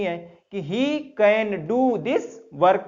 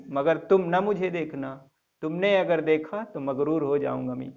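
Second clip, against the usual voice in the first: a low, soft recital of a love couplet; soft, unhurried shayari reading; poem recitation; 4 semitones lower, 5 dB quieter than usual. Acted. Recites a couplet before the lesson.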